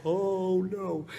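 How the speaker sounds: high, wailing tearful "oh"; mock crying. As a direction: wail it high as if welling up. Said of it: "it makes me cry".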